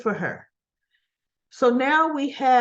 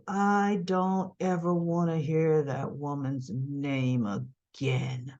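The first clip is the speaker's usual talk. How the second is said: low, slow, drawn-out warning; grave refusal; 7 semitones lower, 5 dB quieter, slower than usual. Retold or imitated. Woman refusing to hear about her rival, grave refusal. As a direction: draw out each word with quiet finality.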